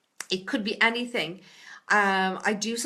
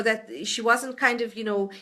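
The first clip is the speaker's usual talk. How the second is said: ordinary talk, nothing marked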